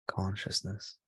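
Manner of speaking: ordinary talk, nothing marked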